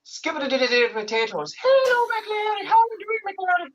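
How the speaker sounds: very high, sing-song Irish leprechaun; squeaky, hammed-up Irish patter; Irish accent. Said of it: "And Irish is like".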